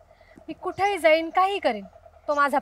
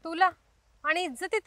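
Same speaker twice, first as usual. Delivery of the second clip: ordinary talk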